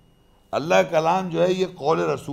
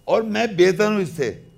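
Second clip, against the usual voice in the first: arrogant boast; 4 semitones higher, 6 dB louder than usual. Retold or imitated. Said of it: "تو اس نے reason دی".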